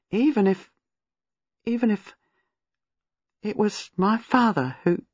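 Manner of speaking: a touch of distress, breaking off mid-thought; faltering, trailing off. A narrator's reading. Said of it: "but she couldn't go on".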